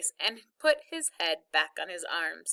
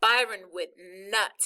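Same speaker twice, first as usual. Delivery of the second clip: plain reading voice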